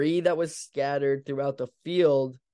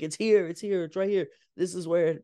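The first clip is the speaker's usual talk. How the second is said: imitated excited cry; 4 semitones higher than usual. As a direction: mimic him finding the spot. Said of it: "Carlos is like".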